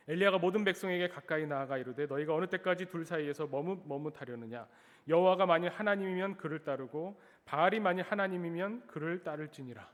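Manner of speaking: 힘주어 크게 읽는 성경 낭독; 회중에게 말씀을 또박또박 선포하듯. Acted. Reads scripture aloud. (큰 소리로 성경 낭독)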